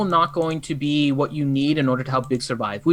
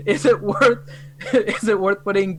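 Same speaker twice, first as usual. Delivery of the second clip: laughing; 5 semitones higher than usual. Real, not acted. Laughing at the fake accent.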